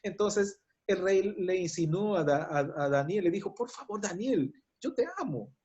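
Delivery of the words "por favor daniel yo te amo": hushed, higher plea, a king begging; soft pleading. Retold or imitated. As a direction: beg him softly, almost breaking. (súplica suave y aguda)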